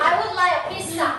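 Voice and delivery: I would like a pizza, each word slow and stressed on like; slow model sentence. Shown as a demonstration. Teacher slowly models the phrase for her child, stressing like. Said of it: "like ต้องด้วยนะ".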